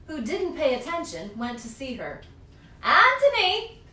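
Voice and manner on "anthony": put-on high, loud, slow old witch voice calling Anthony; Strega Nonna calling. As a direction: call his name like a grandma witch, stretched and loud. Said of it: "said Strega Nonna".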